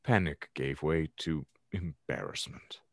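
normal talking voice

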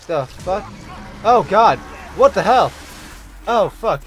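shocked, high, loud cries; startled and scared, yelling; panicking gamer. Real, not acted. Jump scare from phone voice.

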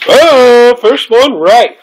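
high, loud cheering; excited cheer. Real, not acted. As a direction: cheer like you just won. Celebrating first correct guess.

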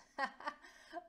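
bright loud laugh; hearty laugh. Acted. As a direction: laugh out warmly. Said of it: "Velius laughs".

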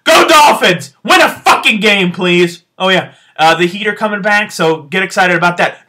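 ordinary talk, nothing marked

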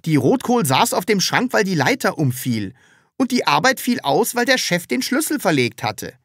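insistent, argumentative character; Taschenbier arguing back. Acted. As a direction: reason it away, point by point.

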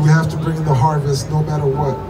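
ordinary talk

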